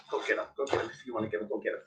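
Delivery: soft, hushed urging; whispered egging on. Real, not acted. Whispering to her dog, coaxing it to chase.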